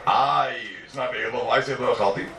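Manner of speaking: ordinary talk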